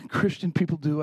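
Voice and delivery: normal talking voice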